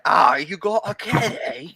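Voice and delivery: slow, deliberately bad cockney accent; hammed-up cockney, talking out the side of the mouth. Teasing her friend about the kitty cat in bad cockney. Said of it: "just cockney and be like".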